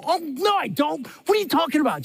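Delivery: mock yell, voice jumping up in protest; indignant yell. Husband snapping back at wife as she walks away, mock indignant yell. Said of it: "I go ... Just like that".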